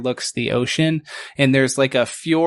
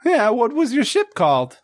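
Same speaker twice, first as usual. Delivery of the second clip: high squeaky voice, doubtful; skeptical otter shipwright; 9 semitones higher than usual. Acted.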